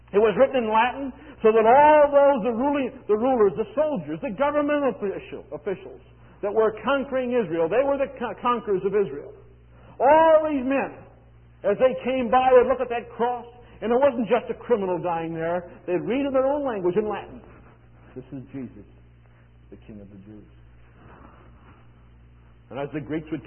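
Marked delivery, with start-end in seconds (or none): 0.1-17.4 raised, emphatic pulpit voice; impassioned preaching. Real, not acted. Preaches at full force. 18.1-20.5 very soft, low recitation; solemn hush. Retold or imitated. Hushed reading of the inscription.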